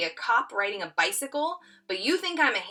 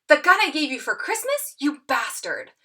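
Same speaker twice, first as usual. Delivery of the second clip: acted indignant reply; 6 semitones higher, 5 dB louder than usual.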